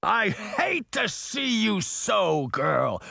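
high, drawn-out roaring vow; fierce roar. A narrator's reading. Titan vows to rescue the grandmother, fierce roar. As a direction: roar it out slowly, swearing vengeance. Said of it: "Titan roared fiercely".

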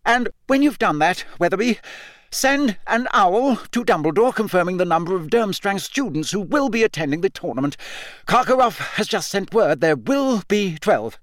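high, raving official dictating orders; Mister Crouch. A narrator's reading. Deranged official talking to a tree, high and fussy. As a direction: rattle off orders to someone who isn't there. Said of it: "Muttering and gesticulating".

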